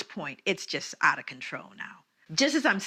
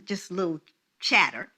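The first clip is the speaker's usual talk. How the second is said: ordinary talk, nothing marked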